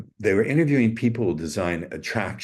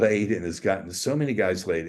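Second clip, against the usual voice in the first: ordinary talk, nothing marked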